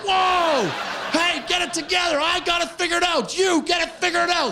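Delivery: high startled yell; shouting. Acted. Man popping out of a trunk yells at the girl, loud and high. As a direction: bellow it out in a sudden panic.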